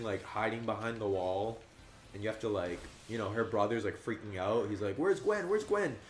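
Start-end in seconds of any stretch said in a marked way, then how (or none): 5.0-6.0 high panicked mock cry; imitating a frantic brother, higher; mock-panicked high calling. Brother freaking out, retold.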